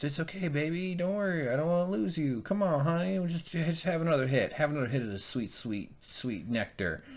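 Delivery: lightly voiced doting sister soothing him; mock cooing Cersei. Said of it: "And she goes".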